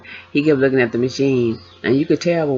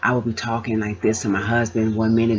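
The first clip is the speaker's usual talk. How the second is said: ordinary talk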